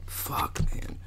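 breathy whispered fuck; whispered curse. Real, not acted. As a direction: whisper it in defeat. Whispered despair.

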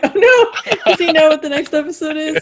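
high, loud laughing alarm; comic retold worry; mock panic. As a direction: act out dismay while laughing. Retelling own panic, laughing.

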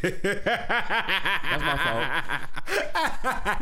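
drawn-out high laughing mock apology. Real, not acted. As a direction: stretch it out laughing. Mocking repeat with laughter.

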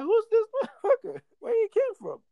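high, laughing mock surprise; laughing disbelief. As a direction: laugh through a retold what-the. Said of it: "I'm like".

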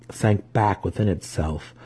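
plain reading voice

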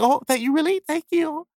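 put-on squeaky high girl voice; flattered girl. Girl thanking a flatterer, squeaky and high. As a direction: coo it way up high. Girl taking compliment again.

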